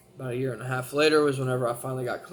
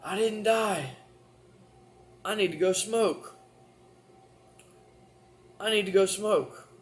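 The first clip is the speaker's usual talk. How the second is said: high, louder insistent craving; stubborn addict; 6 semitones higher, 3 dB louder than usual. Retold or imitated. Addicted teen telling family he needs a smoke, insistent. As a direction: demand a smoke, voice pushed up. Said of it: "my very first sentence".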